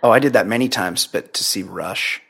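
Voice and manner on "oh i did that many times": ordinary delivery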